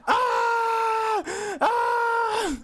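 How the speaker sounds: repeated high mock screams; two quick movie-style screams; mock nightmare screams. Shown as a demonstration. Denying he wakes screaming, mocks the scream. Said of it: "I've never woken up going".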